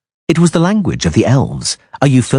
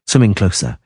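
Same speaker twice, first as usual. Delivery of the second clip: plain reading voice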